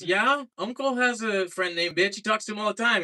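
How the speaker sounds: lightly voiced little sister. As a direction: play the innocent kid sister explaining. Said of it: "She goes".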